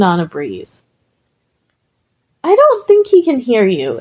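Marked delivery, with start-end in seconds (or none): 2.4-4.0 offering. Acted. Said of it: "Izuku offered".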